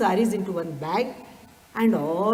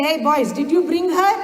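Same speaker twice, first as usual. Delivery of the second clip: raised, eager question; calling out; 6 semitones higher, 5 dB louder than usual. Retold or imitated. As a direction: call out to the boys, pitched up. Swami calls out to waiting boys.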